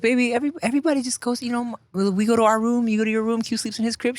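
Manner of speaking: put-on high-pitched talking-to-a-child voice; acted parent reasoning with a little one; dad explaining gently. Dad explains bedrooms to small daughter, high coaxing voice. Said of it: "I was like".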